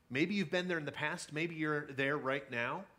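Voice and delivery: ordinary talk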